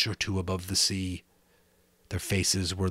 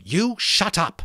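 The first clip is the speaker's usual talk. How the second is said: angry; 12 semitones higher, 7 dB louder, slower than usual. Acted. Ralph snapping at Piggy.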